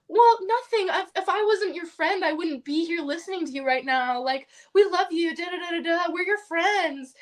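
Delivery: high, singsong mock reassurance; condescending baby-talk. Upline reassuring an upset friend, condescendingly. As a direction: imitate someone soothing her as if she were little. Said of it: "talking to me like I'm a baby".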